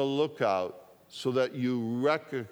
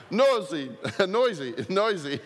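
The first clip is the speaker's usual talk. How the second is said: laughing. Real, not acted. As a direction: chuckle through the words.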